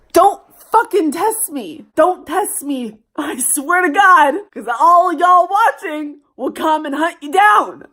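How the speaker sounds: loud threatening warning; threatening the ex who watches; fierce warning. Real, not acted. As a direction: warn him off, half joking. Warning her boyfriend on camera.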